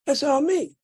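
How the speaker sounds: high, proud retold brag; imitated show-off; boastful. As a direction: claim all the credit. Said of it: "I'm taking credit for everything".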